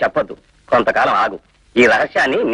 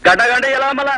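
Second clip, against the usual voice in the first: high-pitched, insistent objection; animated protest; 9 semitones higher than usual. Acted. Host protests at leaving so soon.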